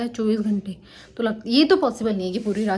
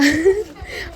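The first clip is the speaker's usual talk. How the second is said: a short bright burst of laughter, higher; real laugh; 8 semitones higher, 4 dB louder than usual. Real, not acted. Bachchon ki shararat par hansi. (खिलखिलाकर हँसी)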